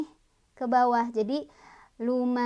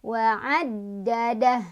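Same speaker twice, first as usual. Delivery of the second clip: Wa'addadah slow with the dal pressed hard; 4 dB louder, much slower than usual. Shown as a demonstration. Teacher showing students the pressed dal in Wa'addadah. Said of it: "Ditekan dal".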